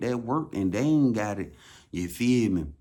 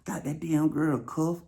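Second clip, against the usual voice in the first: high gloating; 7 semitones higher, much slower than usual. Acted. Gloating after hug.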